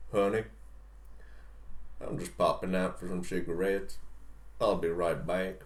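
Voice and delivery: low Southern husband slipping out; husband's casual Southern goodbye; Southern accent. Acted. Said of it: "have to use a Southern accent".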